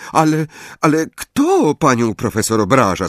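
timid Parvati. A narrator's reading. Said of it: "zapytała nieśmiało Parvati".

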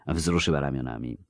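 usual speaking voice

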